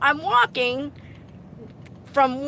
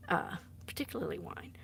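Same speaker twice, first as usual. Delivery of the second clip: whispered aside; 4 semitones lower, 13 dB quieter, faster than usual. Real, not acted. Admitting quietly.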